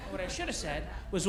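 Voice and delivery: ordinary talk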